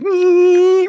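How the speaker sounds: high, loud mechanical "nee" whine; vacuum whir. Retold or imitated. Comic imitating the robot vacuum rolling over, high whirring noise. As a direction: squeal like a little motor.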